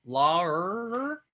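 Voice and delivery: "Lawyer" as a long rising "larrr"; Boston-style attempt. Shown as a demonstration. Co-host retrying Boston "Lawyer", exaggerated long R. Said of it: "So how do you say lawyer?".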